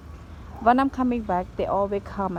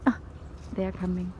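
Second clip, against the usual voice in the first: ordinary delivery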